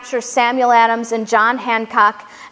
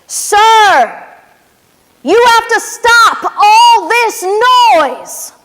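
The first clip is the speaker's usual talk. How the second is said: raised, drawn-out scolding guard voice; stern sentinel; 8 semitones higher, 6 dB louder, much slower than usual. Acted. Sentinel scolding Revere in the night, raised and stern. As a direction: hush the loud rider like a stern sentry.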